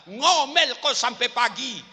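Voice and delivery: taunting. Acted. Husband taunting wife in well.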